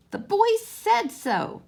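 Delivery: high, slow insistent little voice; little rabbit insisting. A narrator's reading. Toy rabbit insisting to the furry rabbits, high and slow. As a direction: insist slowly in a small high voice. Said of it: "said the little rabbit".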